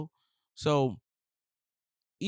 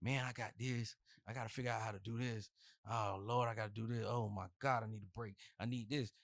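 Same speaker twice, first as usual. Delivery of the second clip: mock complaining; 4 semitones lower, 10 dB quieter than usual. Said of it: "I get the pillow talking".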